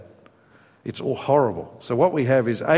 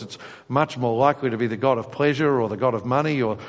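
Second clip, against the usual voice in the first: ordinary talk, nothing marked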